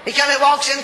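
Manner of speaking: usual speaking voice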